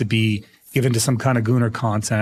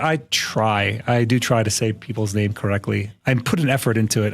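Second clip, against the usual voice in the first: usual speaking voice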